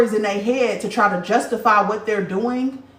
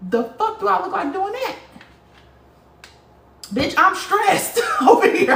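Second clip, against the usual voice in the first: high, laughing, slowed stressed cry; laughing exasperation; 12 semitones higher, 4 dB louder than usual. Real, not acted. To gossiping rivals, real laughing exasperation. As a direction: laugh it out, fed up.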